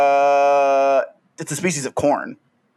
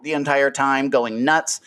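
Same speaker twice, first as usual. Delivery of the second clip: ordinary talk, nothing marked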